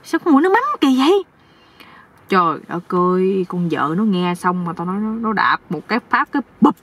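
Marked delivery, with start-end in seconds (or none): 0.0-1.2 high-pitched startled exclamation; crying out. Acted. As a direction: cry out, quick and high, in disgusted surprise. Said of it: "mới la luôn". (kêu toáng lên)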